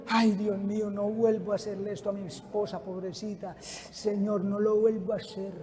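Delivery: very soft, low mock remorse; contrite husband. Cheating husband praying as his wife cries, hushed low contrition. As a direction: murmur it as a penitent plea to God. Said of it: "y la mujer llorando".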